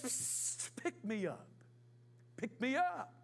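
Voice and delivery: hushed, hissing start, then a coaxing snake; sly snake voice. Acted. Snake coaxing the boy on the path, soft and sly. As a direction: lure the boy in like a tempting snake.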